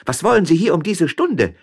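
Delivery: high, loud outraged questions; indignant shouting. A narrator's reading. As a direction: fire off shocked, scandalised questions. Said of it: "schrie die Müllerin mehr entrüstet als erschrocken".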